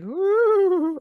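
high ghostly woo imitating her own sob; mock wail, high and hooting; imitated hysterical cry. Showing listeners how she cried, a high ghostly woo. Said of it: "like that".

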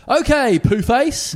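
put-on high, loud cheeky comeback as a child; cheeky kid. Daughter cheekily answering Mum back, mock-bratty. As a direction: sing out a bratty retort to Mum.

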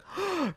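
high little imitated gasp; mock gasp. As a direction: gasp like a stunned fan. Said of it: "two people in the audience were like".

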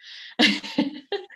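a breathy giggle up high; unvoiced high giggle; high breathy laugh. Real, not acted. Laughs at own story.

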